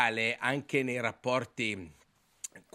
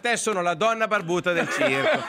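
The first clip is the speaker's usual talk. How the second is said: high, loud, slow and laughing; excited complaint; 8 semitones higher, 4 dB louder than usual. Real, not acted. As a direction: pile on the mock outrage. Heated teasing complaint.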